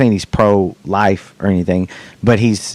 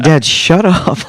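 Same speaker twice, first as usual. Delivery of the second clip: exasperated; 9 semitones higher, 4 dB louder, slower than usual. Retold or imitated. Retold exasperated reply to dad.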